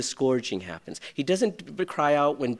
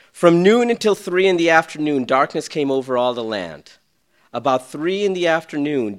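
loud scripture reading; 4 semitones higher, 8 dB louder than usual. Acted.

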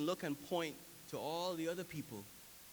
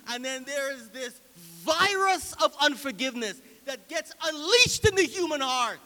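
higher, much louder exhortation; full-force preaching; 10 semitones higher, 13 dB louder than usual. Real, not acted. Preacher warning congregation about unforgiveness, at full force. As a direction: drive each warning home with rising force.

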